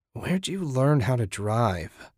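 slow, croaked dazed question; dazed croak at her roommate after the wreck. A narrator's reading. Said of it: "Susan croaked".

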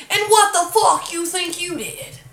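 high, loud, scrunched-up taunt; evil mock yell. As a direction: re-enact an ugly outburst at full volume. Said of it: "I said it exactly like that".

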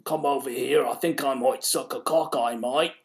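Popeye-like British voice; exaggerated, oddball British accent; mock British accent. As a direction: ham up a cartoon Brit. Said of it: "she talked like that, like fucking Popeye".

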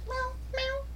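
high cooing meow at a cat. Pretend. Meowing at the cat.

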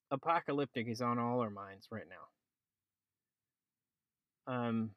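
muffled, talking under a blanket. Real, not acted. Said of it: "said the man talking under a blanket".

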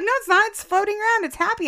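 high, insistent protest; childlike denial. Retold or imitated. Young guard insisting his Tamagotchi is fine, high protest. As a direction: defend it like a child would.

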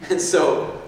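ordinary talk

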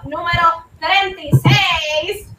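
slow, high, sing-song welcome; podcast host intro. Acted. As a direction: stretch the greeting out musically. Sung show opening.

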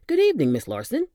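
a chipper orderly breezing into the room; cheerful orderly. Acted. Orderly greeting his patient, chipper and bright.

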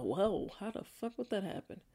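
hushed fast aside; quiet fast aside; whispered aside. Real, not acted. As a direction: mutter it quickly under breath. Muttered aside to herself.

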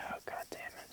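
whispered curse under the breath; real frustration kept to a whisper; angry whisper. Real, not acted. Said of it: "Sorry, I'm getting angry again".

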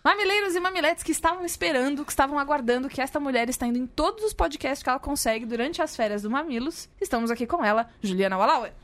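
upbeat show host introduction; apresentador animado. Acted. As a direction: announce the guest with flair. Anunciando a convidada. (anúncio animado de apresentador)

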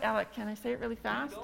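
fast, hurried question to the tech; rushed. Real, not acted. Pastor out of time asks Alec for a minute, rushed and fast. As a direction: rattle it off like you're out of time.

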